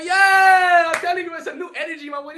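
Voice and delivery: big, high "Yeah!" then a pumped line; hyped shout. Real, not acted. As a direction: yell it out fired up. Hyped outburst.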